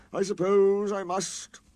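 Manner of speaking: slow, high sulking grudge; sulky. A narrator's reading. Sir Handel sulkily giving in to the fireman. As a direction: sulk through it slowly. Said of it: "said Sir Handel sulkily".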